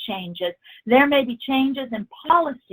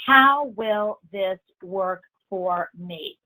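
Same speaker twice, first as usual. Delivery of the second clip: slow, evenly spaced, "how" held long; stress on how; 3 semitones lower, much slower than usual. Shown as a demonstration. Trainer encourages asking consistently, slowly stressing "how". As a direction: hold "how", then place each word deliberately. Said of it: "Notice I emphasize the word how".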